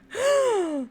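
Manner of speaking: big, high shocked gasp; scandalized intake of breath; theatrical gasp. Acted. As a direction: gasp in mock horror. Acted shock at the suggestion.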